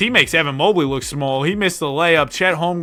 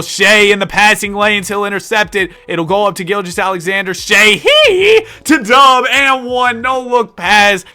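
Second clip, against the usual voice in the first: rising, louder commentator call slowing on the pass; excited play-by-play call; 5 semitones higher, 8 dB louder than usual. Acted. Game streamer calls the fast break, hyped play-by-play. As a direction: sell the fast break like a TV announcer.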